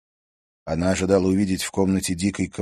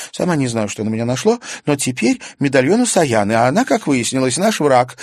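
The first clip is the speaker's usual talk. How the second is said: put-on higher young girl's voice, rueful; girl Karina voiced; 8 semitones higher, 7 dB louder than usual. A narrator's reading. Karina admits her foolishness to Stas, higher girl's voice. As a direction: play Karina, higher and lighter, sheepish.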